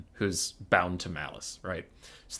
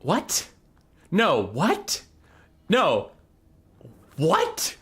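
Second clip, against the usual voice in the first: high incredulous yelps; can't believe what he just heard; rising, baffled what, climbing higher each time; stunned disbelief; 7 semitones higher, much slower than usual. Retold or imitated. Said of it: "And I'm like".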